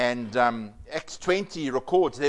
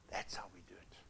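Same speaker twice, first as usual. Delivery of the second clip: whispered aside; hushed, confiding whisper; 8 semitones lower, 19 dB quieter than usual. Real, not acted. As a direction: drop to a breathy whisper. Sermon point, whispered.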